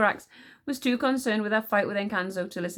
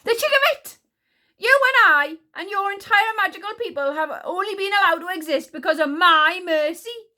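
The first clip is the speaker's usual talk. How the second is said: very high, loud indignation; outraged temper; 11 semitones higher, 8 dB louder than usual. A narrator's reading. Insulted queen reminding Encanso of her mercy, outraged. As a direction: explode with regal outrage.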